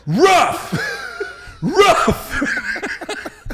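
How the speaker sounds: high, loud dog bark shout. Retold or imitated. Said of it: "went like this".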